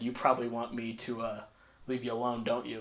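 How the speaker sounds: low, soft, awkward murmur; hushed. Retold or imitated. Gym stranger offering to leave the kid alone, hushed. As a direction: imitate a stranger speaking low into a silence. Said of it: "And it was really silent".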